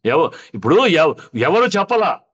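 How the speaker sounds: loud, stumbling, heated retort; real heated challenge. Real, not acted. Guest disputing the host's claim of an apology, loud and heated. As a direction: burst in loudly, tripping over the first words.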